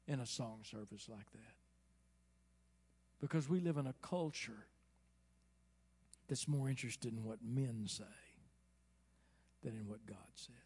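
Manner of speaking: ordinary talk, nothing marked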